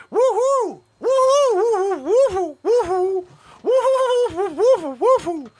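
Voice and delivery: host mocking Zabrosk's nonstop loud high whoops; repeated woo-hoo yells. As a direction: whoop over and over like a hype man. Imitating Zabrosk's endless woo-hoos.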